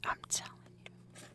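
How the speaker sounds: breathy whispered aside. Real, not acted. Whispering to the viewers, a private aside.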